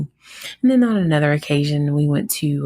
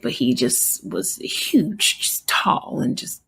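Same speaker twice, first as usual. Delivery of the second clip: whisper; 4 semitones higher, 3 dB quieter, slower than usual. Real, not acted. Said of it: "describing a huge man".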